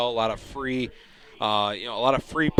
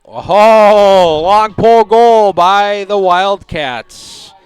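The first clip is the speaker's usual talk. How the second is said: excited goal call; 9 semitones higher, 17 dB louder, much slower than usual. Real, not acted. Goal call in live play-by-play.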